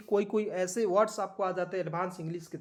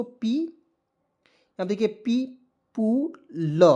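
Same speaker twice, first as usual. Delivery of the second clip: People drawn out very slowly; slow model word; much slower than usual. Shown as a demonstration. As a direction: stretch it out for learners. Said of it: "क्या पढ़ेंगे दोस्तों". (People को बहुत धीरे बोलते हुए)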